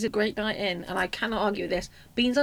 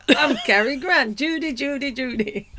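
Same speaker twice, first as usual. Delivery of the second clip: slow, hammed-up film-star drawl of Judy; a lightly voiced Cary Grant, laughing; bad Cary Grant; 3 semitones higher, slower than usual. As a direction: her imagined perfect Cary Grant, half laughing. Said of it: "Count Arthur Strong doing an impression of Cary Grant".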